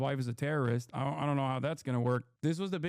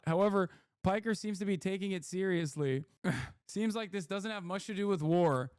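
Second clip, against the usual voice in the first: news report read; 4 semitones higher than usual. Acted. Reads out a play-by-play summary.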